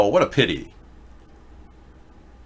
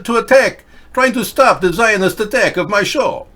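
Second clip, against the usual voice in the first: put-on higher, excited Russian accent, broken English; mock Russian accent; 10 semitones higher than usual. Host taunting viewers in a mock Russian accent. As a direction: rant in a hammed-up Russian accent. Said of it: "I even do a better Russian accent than you people do".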